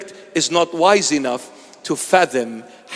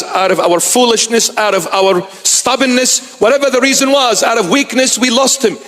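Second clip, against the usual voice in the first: higher, rising preacher's plea; impassioned preaching; 7 semitones higher than usual. Acted. Preacher lamenting to his flock, impassioned and higher. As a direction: build the lament toward the congregation.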